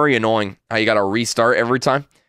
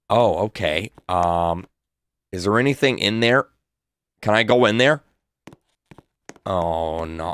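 usual speaking voice

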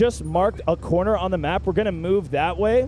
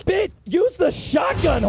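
yelling; 9 semitones higher, slower than usual. Real, not acted. Yelling at teammate.

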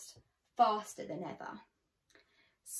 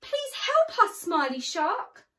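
much higher, louder desperate plea; pleading cry; 8 semitones higher, 8 dB louder than usual. Acted. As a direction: beg the shark to save them. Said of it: "cried the fish".

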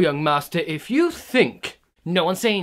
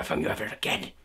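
sudden whisper; 4 semitones lower, 6 dB quieter, faster than usual. Acted. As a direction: drop to a breathless whisper.

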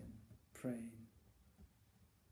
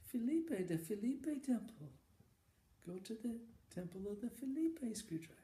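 put-on old monk proclaiming; 10 semitones higher than usual. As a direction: announce the holy temple like a sage. Monk sends Randy to temple.

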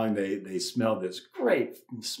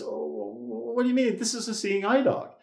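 high, airy feigned innocence; mock innocent surprise; 10 semitones higher than usual. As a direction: play the wide-eyed innocent, pitched way up. Chihuahua owner feigns innocence.

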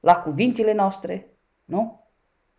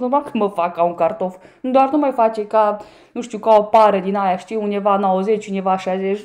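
normal talking voice

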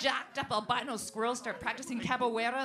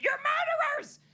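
high, loud accusing yell; 7 dB louder than usual. Retold or imitated. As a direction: scream it at them. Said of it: "yelling at the people".

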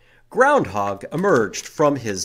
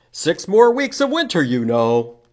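groundhog voice; 6 semitones higher, 8 dB louder than usual. Acted.

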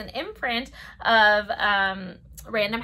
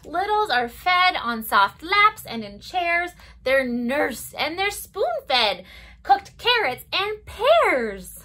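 lilting storybook read-aloud; circle-time story voice; reading to children; 3 semitones higher, 5 dB louder, slower than usual. Acted. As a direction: read it gently to toddlers. Reads picture book to children.

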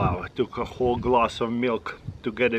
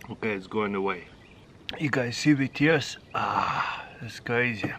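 ordinary talk

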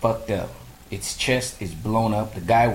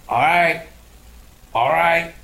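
hopeful all right; 7 semitones higher, 5 dB louder, slower than usual. Retold or imitated. Hyped anticipation in theater.

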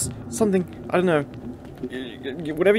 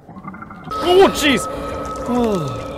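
high startled cry; 10 semitones higher, 6 dB louder, much slower than usual. Real, not acted. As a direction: jump at the scare. Another jump scare.

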